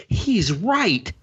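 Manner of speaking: high, swooping astonished agreement; mock amazed realization. Fan convinced by a cartoon hero's nonsense, mock amazed realization. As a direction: marvel as if won over completely. Acts out being won over.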